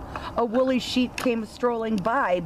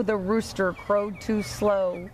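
plain reading voice

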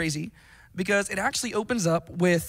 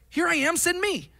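"Here I am, send me" thrown out much higher, bursting with eagerness; eager volunteer; 11 semitones higher than usual. Retold or imitated. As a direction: jump at the chance.

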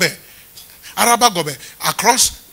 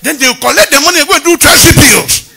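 raised punchline; 7 semitones higher, 9 dB louder than usual. Acted.

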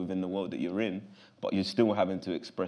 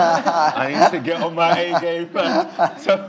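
laughing through the words, louder and higher; 8 semitones higher, 9 dB louder, slower than usual. Real, not acted. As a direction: laugh it out as you say it. Laughing at own fear of critics.

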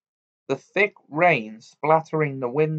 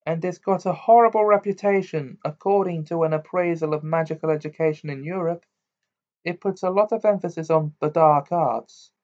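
lightly voiced schoolgirl, high; a hint of a bookish know-it-all; Hermione; 6 semitones higher, 4 dB louder than usual. Girl informing friends of a school's bad reputation, lightly voiced high.

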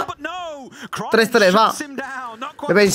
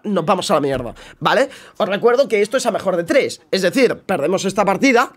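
ordinary delivery

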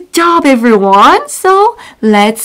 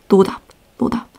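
exasperated student; 4 semitones lower, 7 dB quieter, much faster than usual. Retold or imitated. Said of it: "the student's like".

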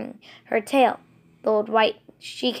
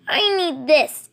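announcing; 6 semitones higher, 4 dB louder, slower than usual. Acted. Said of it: "he announced".

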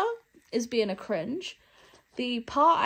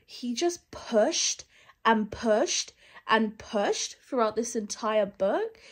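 normal talking voice

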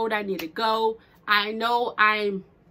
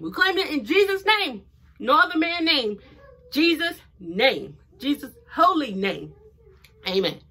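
raised, forceful church-style proclamation; fervent prayer; 5 semitones higher, 4 dB louder than usual. Real, not acted. Praying over her business in Jesus' name, fervent. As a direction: claim it like a preacher, punching each phrase.

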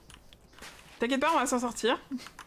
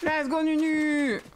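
excited cheer; 4 semitones higher, much slower than usual. Real, not acted. Cheering on pet mount.

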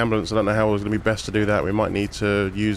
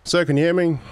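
calling out; 7 semitones higher, 3 dB louder than usual. Real, not acted. Paramedic calling to unconscious patient.

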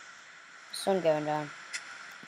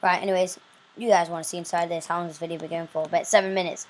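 normal talking voice

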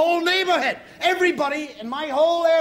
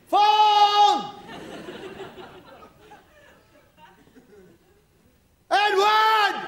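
yelling upstairs; 8 semitones higher, much slower than usual. Retold or imitated. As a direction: bellow the son's name up the stairs. Dad yelling up the stairs.

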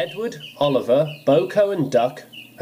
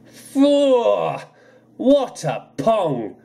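disgusted exclaim; 8 semitones higher, much slower than usual. Acted. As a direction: recoil from the fishy stink. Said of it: "hear him exclaim".

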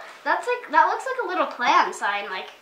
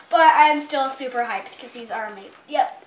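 ordinary talk, nothing marked

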